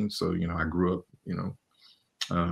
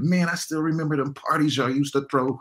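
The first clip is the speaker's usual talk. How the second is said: higher, excited voice of people remembering; hyped-up old partygoer; 8 semitones higher than usual. Retold or imitated. Old partygoers recalling the parties, hyped and higher. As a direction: gush about those parties like a fan. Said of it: "they talk about it".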